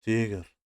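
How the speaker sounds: تیگر said as Russian "tigr", a short loud syllable; تیگر as a teacher's emphatic example; Russian word. Shown as a demonstration. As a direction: model تیگر sharply, falling at the end. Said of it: "مثل".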